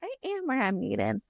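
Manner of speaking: small high-pitched put-on voice; stubborn little dragon. Mimics stubborn little Andarna.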